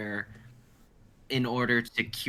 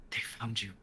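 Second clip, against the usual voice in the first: hushed, breathless warning of doom; frightened old man whispering; terrified whisper; 7 dB quieter than usual. Acted. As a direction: whisper it like they're already at the door. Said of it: "his face pale with terror".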